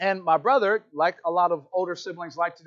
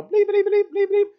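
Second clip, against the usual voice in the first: high, monotone bleeping; imitated censor bleeps; 13 semitones higher, 5 dB louder than usual. Describing the boss to a newcomer, high monotone bleeps. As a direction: beep out the words like a censor.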